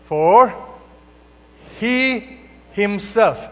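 slow, stressed he himself, each word weighed; preacher slows to stress he himself for the congregation; emphasis on he himself. Shown as a demonstration. Leaning hard on he himself. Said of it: "That's for emphasis".

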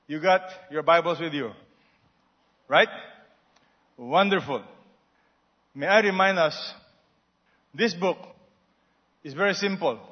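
ordinary delivery

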